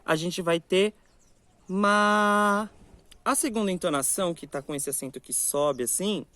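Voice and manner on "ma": "ma" held long on a high, level pitch. Shown as a demonstration. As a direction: sustain "ma" evenly without letting the pitch move. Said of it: "uma entonação alta e longa".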